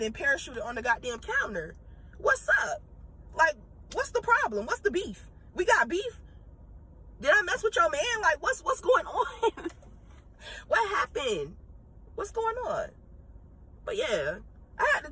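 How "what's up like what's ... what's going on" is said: high, pushy mock challenge to a coworker; sassy confrontation. Vlogger mocking a rude customer, sassy high challenge. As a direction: needle them like you're starting beef.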